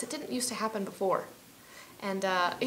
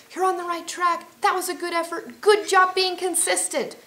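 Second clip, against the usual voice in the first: cheering on; 10 semitones higher, 6 dB louder than usual. Pretend. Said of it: "Will you say things like".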